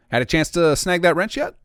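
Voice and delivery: casual questioning NPC; recorded NPC line. Acted. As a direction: ask it like a friendly quest giver. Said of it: "we'll go ahead and record this".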